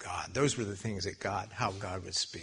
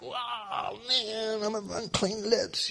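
high, drawn-out wail; mock awestruck cry; acted overwhelmed awe; 10 semitones higher, much slower than usual. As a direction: imitate a prophet stunned and undone. Acts Isaiah overwhelmed by God's holiness.

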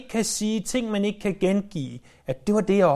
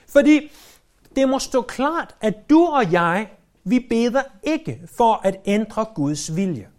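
full-force preaching. Acted. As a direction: drive the point home to the congregation.

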